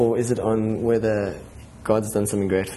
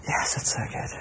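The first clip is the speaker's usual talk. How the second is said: whispered aside; 8 dB quieter than usual. Retold or imitated. Retells quiet inner response.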